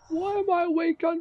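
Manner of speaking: high, loud laugh through the words. Real, not acted. Drunk gamer laughing at his mates' jibe.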